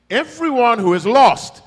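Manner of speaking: loud, high preaching cry; loud proclamation. Real, not acted. Preacher declaring the fate of the lost, loud. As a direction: call it out over the room.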